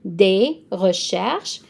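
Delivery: "des recherches" drawn out slowly, "des" like "day"; teacher modelling the word slowly; slow French model. Shown as a demonstration. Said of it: "D E S is des".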